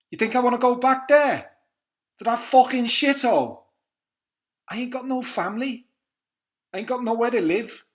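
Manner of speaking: loud, high, raging London prisoner; furious Cockney speech, high and loud; angry London accent. Acted. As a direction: spit it out like a cornered young inmate from London. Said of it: "it's not a bad accent".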